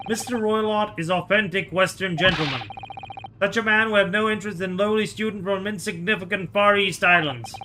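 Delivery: put-on lightly Russian-accented, dismissive guard; a hint of a Russian accent, looking down on them; mock Russian sailor sneering.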